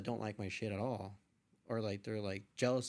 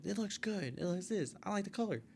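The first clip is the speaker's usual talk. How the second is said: higher-pitched imitation of admirers; mock gushing in a raised pitch; gushing classmates; 7 semitones higher than usual. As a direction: lightly mimic classmates piling on praise. Classmates praising his photo, acted out.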